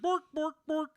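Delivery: high sing-song mock-Swedish gibberish; high, comic Swedish cook voice; Swedish Chef. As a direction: babble nonsense in a lilting Scandinavian chant, ending on borks. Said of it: "like the Swedish chef from the Muppets".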